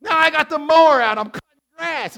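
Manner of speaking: can you believe it, cry it out; thrilled preacher marvelling at mowing in November; amazed excitement. Real, not acted.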